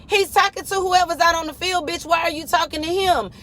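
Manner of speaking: high-pitched retold challenge; acted confrontation. Confronting the mama over a child, high indignant retelling.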